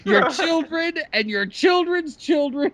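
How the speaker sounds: theatrical high-pitched condemnation; mock solemn curse. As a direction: pronounce a hammed-up curse on a friend.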